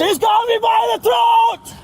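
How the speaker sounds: high, desperate yell; panicked shout. Real, not acted. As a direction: scream it like you're being choked. Man struggling during arrest.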